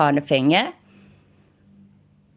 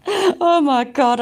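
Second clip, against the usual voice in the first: laughing; 7 semitones higher, slower than usual. Real, not acted. Laughing at own remark.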